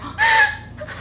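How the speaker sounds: very high, laughing gasp; mock shocked gasp. Friend reacting to being yelled at, high gasp. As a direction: gasp as if startled, giggling. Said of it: "Taylor's face just went like".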